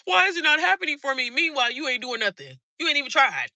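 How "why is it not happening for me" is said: high, loud mocking whine at the sky; mock a lazy dreamer begging God, pitched up; whiny self-pity. Mocking lazy followers who complain but never try, whiny and high. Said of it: "You're like".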